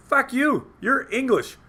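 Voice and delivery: high-pitched mock outburst; retold insult. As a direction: re-enact a heated jab at a friend. Retold insult in drunken argument.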